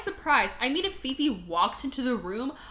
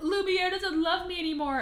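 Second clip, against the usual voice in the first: high, whiny mock sob; crying Fifi; 6 semitones higher than usual. Fifi crying to Missus Potts, high mock-tearful whine. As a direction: wail it as a heartbroken, hammed-up lover. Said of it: "finds a crying Fifi".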